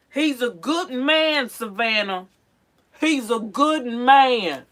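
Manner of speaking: 1 dramatic movie quote. Pretend. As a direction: ham up a movie line. Quoting movie line dramatically.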